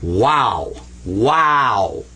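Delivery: wow said slowly, round open vowel; Arabic letter name wow. Shown as a demonstration. Teacher models the letter wow for students. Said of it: "W A W".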